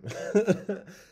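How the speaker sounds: sudden high loud cackle of disbelief; burst of laughter. Real, not acted. Laughing loud at the clerk's shrug about nightly screams. Gas clerk's "all the time.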